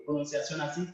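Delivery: ordinary talk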